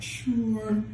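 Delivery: high, stretched "sure"; mock insecure answer. Comic mocking a reluctant yes, high and insecure. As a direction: go high and hesitant. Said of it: "I think it's an insecure answer".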